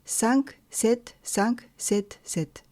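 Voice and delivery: very slow, spaced syllable count. Shown as a demonstration. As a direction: spell out the pattern number by number. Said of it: "la règle de structure".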